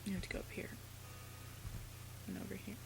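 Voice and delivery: soft hushed whisper; whispered game directions. Real, not acted. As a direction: drop to a secret whisper.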